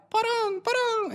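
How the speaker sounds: very high, tiny squeak of a hamster; high chirpy critter voice; squeaky hamster voice. Acted. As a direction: pipe it up like a tiny happy creature. Tiny hamster creature speaks.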